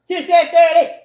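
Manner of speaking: high, very loud outburst; yelling. Real, not acted. Speaker breaking into the stock phrase, yelling. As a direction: yell it out at full force. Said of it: "I just yelled".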